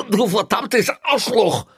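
very high, slow, furious shout; panicked yell. A narrator's reading. Locked-in prisoner cursing through the door, panicked yell. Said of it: "schrie Kreutner mit schreckgeweiteten Augen an".

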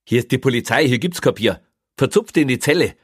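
stern policeman. A narrator's reading. Policeman sending a prisoner to his cell, stern. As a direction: lay down the law, loud and firm.

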